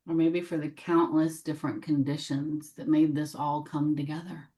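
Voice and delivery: measured meditation voice, long silences between phrases; gently invite, leaving room after each phrase; guided meditation. Real, not acted. Guide welcoming what needs healing, gentle meditation voice.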